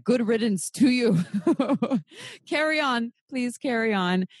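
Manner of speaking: high, laughing dismissal; laughing good riddance. Retold or imitated. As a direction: wave him off with a laugh. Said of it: "Another part of me is like".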